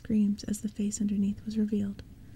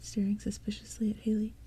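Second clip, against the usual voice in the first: ordinary delivery, nothing marked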